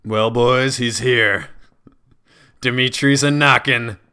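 loud, rallying battle captain; rally the troops, then roar; loud gung-ho soldier. Pretend.

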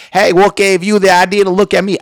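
high, loud mock telling-off; hammed-up indignant TV host; Doctor Phil. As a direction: round on an audience member. Mimicking host berating the wrong person.

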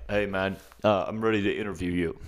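low, soft mock bigwig; lightly voiced self-important interviewer. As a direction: imitate a guy acting like a big shot. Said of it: "he acts like he's a bigwig".

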